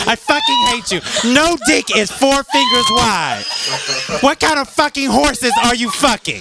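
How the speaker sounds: high-pitched, worked-up rant; yelling. Real, not acted. Telling off a co-host over four fingers, yelling high and indignant. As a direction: yell it in exasperated disbelief. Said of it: "Why are you yelling at me?".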